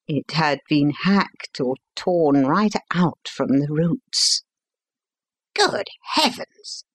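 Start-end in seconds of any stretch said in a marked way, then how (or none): 5.6-6.8 sudden high exclamation of shock; startled doctor's outcry, much higher; shocked cry. A narrator's reading. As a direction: jump up in pitch, horrified. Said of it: "I cried".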